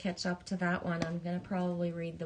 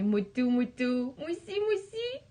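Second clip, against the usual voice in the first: playful giggly singsong; 5 semitones higher than usual. Pretend. Playful French nonsense sounds.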